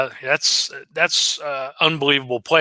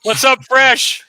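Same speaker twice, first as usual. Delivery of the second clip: high, loud, drawn-out greeting; stretched-out shouted hail; calling out; 9 semitones higher, 6 dB louder, slower than usual. Real, not acted. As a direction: holler a slow hello to a buddy. Hailing a friend who appears.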